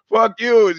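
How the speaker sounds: high, loud playful shout; mock yell. Laughing along with the meme, loud mock insult. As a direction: fling it back like a cheeky heckler. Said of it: "I was like".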